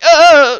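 high, loud comic "ah!"; sudden high-pitched comic yelp; startled scream. Acted. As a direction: cry out in mock alarm, laughing. Acts out own startled reaction.

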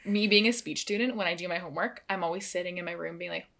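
usual speaking voice

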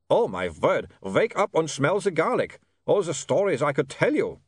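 knowing, teasing vampire voice; vampire Otto. Acted. Otto hints at his stories to William, teasing vampire.

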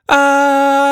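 loud, high scream of fright; wild-ride screaming. A narrator's reading. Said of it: "continued screaming".

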